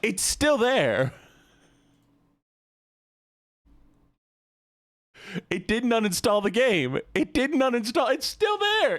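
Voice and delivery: high, frustrated exasperated whine; raging gamer. Real, not acted. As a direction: rage at the stubborn game. Game won't uninstall, frustration.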